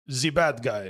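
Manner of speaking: lightly put-on Eastern European accent; a hint of Eastern European movie bad guy. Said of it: "like that Eastern European accent".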